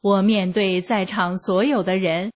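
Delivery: plain reading voice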